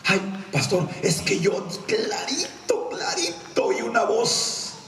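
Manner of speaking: high, drawn-out mock pleading; insistent church member. Church member insisting to pastor, high mock plea.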